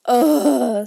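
loud shuddering ugh; shivering ugh. A narrator's reading. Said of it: "with a shiver".

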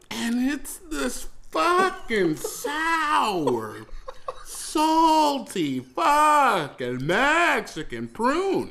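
very slow, stretched-out words, pitched high; disgusted. Retold or imitated. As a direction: drag out every word as if tasting it. Relives the awful taste in story.

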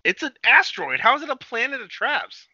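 high, loud disbelieving objection; can't believe how daft this is; incredulous protest. Real, not acted. To his buddy, mocking the title's logic, with a high incredulous protest.

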